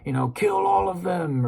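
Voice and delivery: high, loud squealing goblin voice; panicked goblins. Retold or imitated. Goblins recognizing the goblin cleaver, high panicky squeal. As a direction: squeal it out like a goblin who just spotted the sword. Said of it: "they're like".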